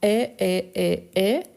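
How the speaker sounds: "Est" as one open eh, repeated identically; identical pronunciation. Shown as a demonstration. Teacher showing the verb avoir forms, identical "Est" each time. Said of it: "La même chose pour le verbe avoir".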